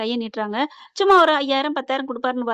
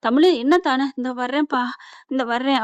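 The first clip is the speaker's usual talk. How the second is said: plain reading voice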